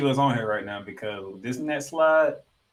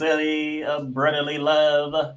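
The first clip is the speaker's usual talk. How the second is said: booming public address announcer; arena announcer voice; slower than usual. Pretend. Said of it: "trying to sound like the O P A announcer of the Spectrum".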